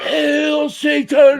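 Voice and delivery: high, drawn-out mock metalhead yell; mock fanatical shout. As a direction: bellow it slowly like a crazed concert fan. Imitating a wild sweaty concert fan.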